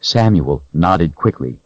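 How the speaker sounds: plain reading voice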